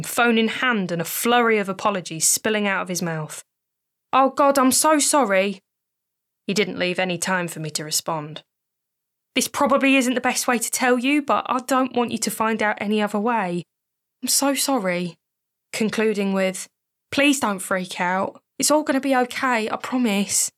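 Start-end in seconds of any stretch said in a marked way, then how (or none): 4.1-5.6 Ian, higher and louder, gushing sorry; frantic apology. Acted. 9.3-20.5 lightly voiced Ian, pleading and rushed; anxious friend.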